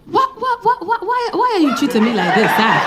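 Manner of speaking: pleading African accent, mock hurt; imitating a pleading African man; African guy voice note. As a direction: whine it like a wounded suitor leaving a voicemail. Voicing the African guy's voice note.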